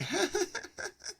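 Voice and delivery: a high squealing laugh; high burst of laughter. Real, not acted. Laughing while telling about the dog sitter.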